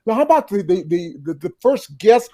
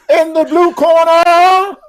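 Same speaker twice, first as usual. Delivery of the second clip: mock ring announcer; 6 semitones higher, 6 dB louder, much slower than usual. Announcer voice for boxing joke.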